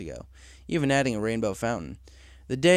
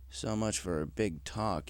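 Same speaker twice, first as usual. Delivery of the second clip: slow, much softer grumble under the breath; grumbled; 4 semitones lower, 10 dB quieter, slower than usual. Acted. Cloud Chaser sees the new pony asleep, grumbling. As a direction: mutter it with disappointment. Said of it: "he grumbled".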